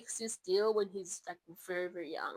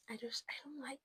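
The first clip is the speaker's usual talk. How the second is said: whisper; 4 semitones higher, 10 dB quieter, faster than usual. Real, not acted. Whispered aside about cup.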